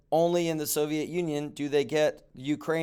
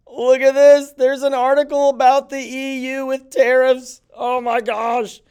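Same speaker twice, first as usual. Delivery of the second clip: excited; 9 semitones higher, 9 dB louder than usual. Acted. Teacher excited by a found article.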